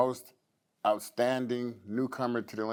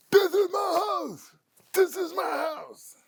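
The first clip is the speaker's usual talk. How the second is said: very high, screaming victory cry; impersonating Nick Walker; 4 dB louder than usual. Retold or imitated. [name] mimics Nick screaming after the win, very high and loud. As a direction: scream it like a champ on stage. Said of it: "[name] has the best impersonation".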